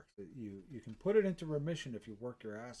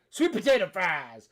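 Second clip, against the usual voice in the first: hammed-up high bellow; mock triumphant shout; 7 semitones higher, 14 dB louder than usual. Climax of mock exorcism.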